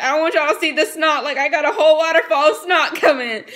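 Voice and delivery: loud, high, comic wail breaking into laughter; retold embarrassed outburst. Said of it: "'cause I was like".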